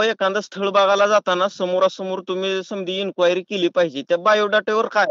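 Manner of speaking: ordinary talk, nothing marked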